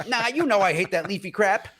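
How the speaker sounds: disgusted refusal. Retold or imitated. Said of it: "she didn't say crap".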